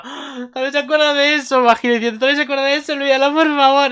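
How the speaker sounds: high-pitched, loud embarrassed wail; flustered character outburst. Acted. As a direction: plead in a high panicky squeal. Acting character's embarrassed plea.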